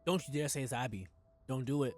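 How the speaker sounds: ordinary delivery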